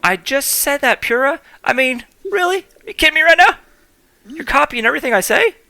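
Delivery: high-pitched exasperated outburst; frustrated. Real, not acted. Complaining that Pyrrha copies everything he says, high exasperated. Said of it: "Got a little frustrated".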